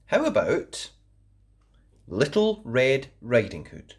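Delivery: ordinary delivery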